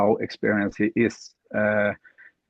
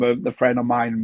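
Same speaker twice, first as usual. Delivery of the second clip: ordinary talk, nothing marked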